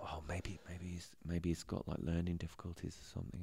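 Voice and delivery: soft whisper, thinking to himself; whispered private guess; whispered thought. Retold or imitated. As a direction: murmur it under your breath.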